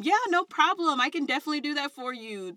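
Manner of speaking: bright, proper customer-service tone; polished professional voice. Retold or imitated. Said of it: "going from".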